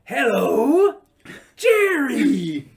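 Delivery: high, loud drawn-out hello; Uncle Leo greeting. Retold or imitated. Uncle Leo greeting Jerry during a pull-up, loud. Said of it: "he says".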